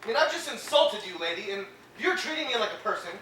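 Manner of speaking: patient yelling at his therapist. Acted. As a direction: tear into her with rising anger.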